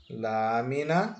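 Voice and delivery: "lâmina" slowly, with a long "lâ"; slow "lâ-mi-na" for a learner; separating syllables. Shown as a demonstration. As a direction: stretch out "lâmina" for syllable counting, rising at the end. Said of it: "Vamos separar as sílabas".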